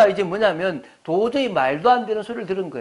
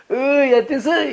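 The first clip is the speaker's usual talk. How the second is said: higher, put-on deacon voice; imitated deacon; 6 semitones higher, 3 dB louder than usual. Deacon calling the preacher's little son, mimicked in a higher voice. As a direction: playfully call out like the deacon. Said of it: "이러는 거예요".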